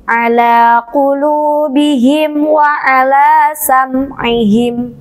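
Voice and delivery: slow chanted recitation; Quran recitation. Shown as a demonstration. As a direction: recite slowly with long vowels. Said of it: "harus ditekan ya".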